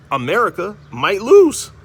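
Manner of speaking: loud and slow, hammering it home; emphatic exclaim. Real, not acted. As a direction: land the punchline hard. Emphatic punchline on scripture claim.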